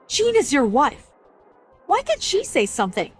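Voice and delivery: indignant rebuke. Acted. Mother to the husband who judged his wife, indignant rebuke. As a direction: take him to task, voice rising high.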